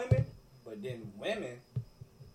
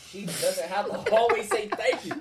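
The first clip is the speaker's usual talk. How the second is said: loud, high, laughing through the point; excited laughing insistence; 6 semitones higher, 8 dB louder than usual. Real, not acted. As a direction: push the point while cracking up.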